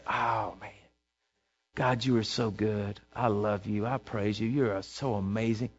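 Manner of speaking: quiet awestruck praise to God; soft adoring prayer. Pretend. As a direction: breathe it out like a grateful worshipper. Believer praising God aloud.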